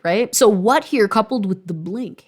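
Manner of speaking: usual speaking voice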